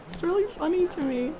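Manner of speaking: quick remark breaking into a high giggle; tickled, giggly and rising in pitch; giggling. Real, not acted. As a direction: start talking, then give in to giggles. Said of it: "makes me giggle".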